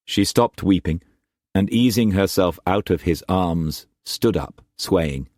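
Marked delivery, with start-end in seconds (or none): none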